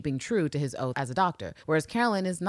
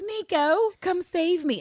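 high, pleading mock damsel; damsel call; 13 semitones higher, slower than usual. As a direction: cry out for your hero, hammed up. [name] calling for rescue.

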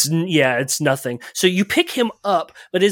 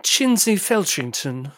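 snooty jockey voice; 4 semitones higher, slower than usual. Acted.

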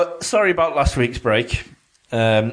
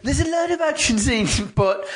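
weird high voice; 9 semitones higher than usual. Retold or imitated. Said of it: "I don't know what that voice was".

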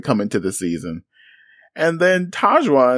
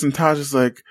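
usual speaking voice

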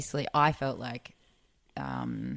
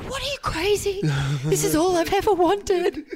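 much higher, loud disbelieving gush; incredulous excitement; 7 dB louder than usual. Retold or imitated. Coworker stunned she'd skip TV, gushing. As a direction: squeal like he can't believe you'd refuse. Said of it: "he was like".

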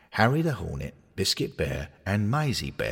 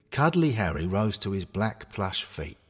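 plain reading voice

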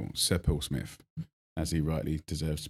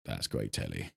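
muttered aside; 12 semitones lower, 10 dB quieter than usual. Retold or imitated. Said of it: "I'm going".

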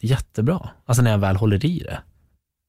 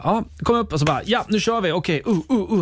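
much higher, eager mock rush; eager self-quote; 10 semitones higher than usual. Son quoting his own eagerness to start, high and eager. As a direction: play your overeager younger self. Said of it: "Jag bara".

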